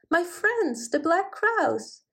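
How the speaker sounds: delighted. A narrator's reading. Delighted at spotting crows.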